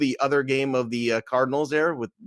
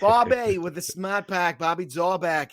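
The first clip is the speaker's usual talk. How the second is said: slow, higher put-on Boston accent; 5 semitones higher, slower than usual. Cheering a Red Sox debut, hammed-up Boston accent. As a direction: ham up a Boston fan's accent, drawing it out. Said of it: "the most in that accent".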